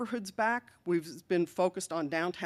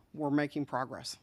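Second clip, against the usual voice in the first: ordinary talk, nothing marked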